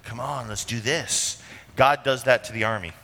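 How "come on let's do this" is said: soft, slow, coaxing aside. Acted. Ahab inducing Jehoshaphat into the war, coaxing. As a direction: coax them quietly, taking your time. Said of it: "for Ahab inducing Jehoshaphat into the war".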